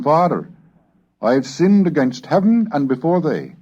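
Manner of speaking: ordinary delivery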